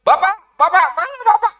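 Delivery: high, loud cry breaking into laughter; mock desperate call. Preacher jokes about crying out to God, laughing loud. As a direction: call out half laughing for a missing father.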